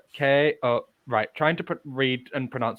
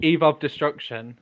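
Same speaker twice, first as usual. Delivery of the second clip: usual speaking voice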